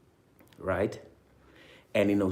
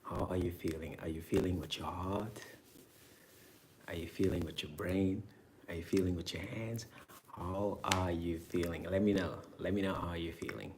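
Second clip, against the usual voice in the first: soft, hushed meditation voice, almost a whisper at first; soft, intimate meditation guide asking how you feel; gentle guided meditation; 5 dB quieter than usual. Acted. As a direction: ease the listener in with quiet, unhurried questions. Opens with soft check-in questions.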